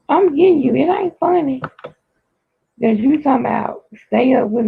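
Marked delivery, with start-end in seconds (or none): 0.1-1.6 raised voice, pitched up, teasing threat; half-laughing mock scold; playful telling-off. Real, not acted.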